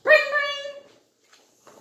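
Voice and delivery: high, loud ringing bell sound; alarm bell ringing. A narrator's reading. Narrator voices the fire station alarm, loud bell ringing.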